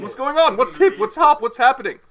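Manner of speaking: high and loud, rattling off the greetings; excited greeting. Acted. Lord Nauticus greeting the guests, excited and high. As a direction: bounce through each one with party glee.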